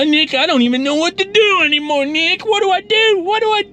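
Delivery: high, whining plea from a friend at his wits' end; desperate whine. Retold or imitated. Friend begging Nick for help, in a high desperate whine. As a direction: pitch it way up, pleading and helpless.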